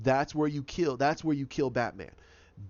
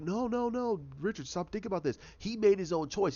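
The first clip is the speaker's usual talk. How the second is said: lightly voiced, higher pleading reassurance; urgent consoling; 6 semitones higher than usual. Others telling Richard it's not his fault, in a higher urgent voice. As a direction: friends pressing Richard to stop blaming himself, higher and urgent.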